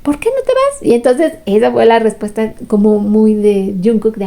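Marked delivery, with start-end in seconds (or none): 0.0-0.8 much higher, overdone dramatic plea; hammed-up melodrama, pitched way up; super dramatic pose. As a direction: ham it up like a soap opera heartbreak. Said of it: "pose súper mega dramática de".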